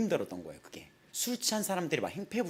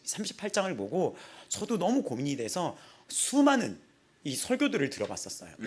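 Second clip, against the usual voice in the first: ordinary delivery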